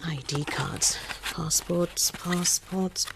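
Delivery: breathy whispered check to herself. Acted. Alone and tense, she whispers a last check of her papers. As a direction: mouth it, just air.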